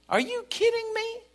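incredulous high taunt; mocking disbelief. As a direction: scoff right in his face. Taunting the devil.

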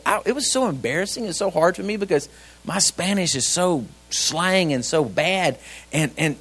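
usual speaking voice

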